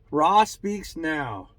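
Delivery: slow, loud, high proclamation as Ra; channeled deity. Acted. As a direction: announce it like an ancient god, drawn out. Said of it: "I don't wanna talk like that".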